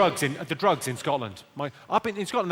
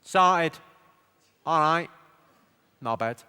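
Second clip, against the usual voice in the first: mock Manchester accent, one word at a time; three slow-spaced words of put-on Mancunian; much slower than usual. Shown as a demonstration. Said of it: "accent's pretty easy for Manchester".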